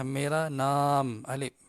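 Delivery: "nam" held long, said slowly; long vowel in nam. Shown as a demonstration. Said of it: "nam tu kena panjang lah". ("nam" dibaca panjang)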